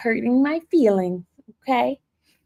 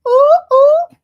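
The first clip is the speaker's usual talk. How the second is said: excited squeal; 6 dB louder than usual. Real, not acted. Excited squeal at block party.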